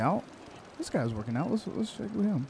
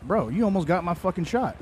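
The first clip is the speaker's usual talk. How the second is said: ordinary talk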